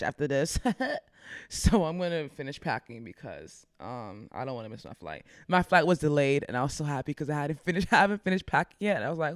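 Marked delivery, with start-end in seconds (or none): none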